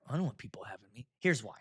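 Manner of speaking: ordinary talk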